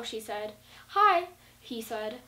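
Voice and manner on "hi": bright greeting. Shown as a demonstration. Acting out dialogue example.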